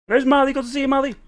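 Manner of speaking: high, bright pet voice greeting Marley; excited high greeting. Real, not acted. Streamer spots his dog Marley, calls out high and excited. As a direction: coo loudly at the dog.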